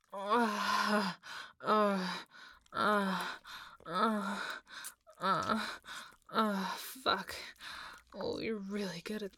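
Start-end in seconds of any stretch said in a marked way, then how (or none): 0.1-7.3 loud, breath-heavy groans; loud moaning. Acted. Speaker to partner in intimate scene, moaning loudly. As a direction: groan out in repeated bursts.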